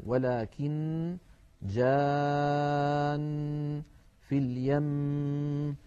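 very slow, held doubled nun and mim; drawn-out shadda. Shown as a demonstration. Teacher reciting the examples in slow tajwid. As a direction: draw out جان and اليم in recitation. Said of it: "الوقف على النون والميم المشددتين".

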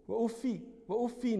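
وأفي as "uffi", then أف as "uffin"; kasra, then with nunation. Shown as a demonstration. Teacher listing dialect forms, وأفي "uffi" then أف "uffin", distinctly. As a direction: end in i, then in i plus n. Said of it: "والكسر والتنوين".

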